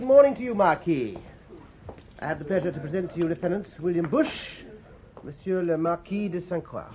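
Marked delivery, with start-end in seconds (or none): none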